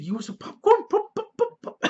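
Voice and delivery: high, playful creepy clown voice; Pennywise clown. Acted. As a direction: pop the words like a giggling clown. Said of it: "He's like".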